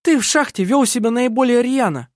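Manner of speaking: high, heated charge; aggressive accusation. A narrator's reading. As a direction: accuse him combatively. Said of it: "агрессивно сказала Керстин".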